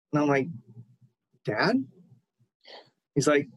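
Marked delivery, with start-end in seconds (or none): 1.4-1.8 questioning rise, retold; tentative disbelief. Retold startled question to dead father.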